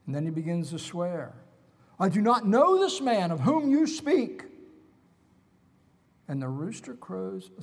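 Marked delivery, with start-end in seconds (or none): 2.0-4.5 1 sworn denial. Acted. Peter swearing his denial.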